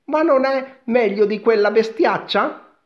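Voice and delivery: put-on high, loud, gleeful boy voice; cheerful boy. A narrator's reading. Giacomino asks about the beanstalk, cheerful and high. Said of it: "con allegra faccia".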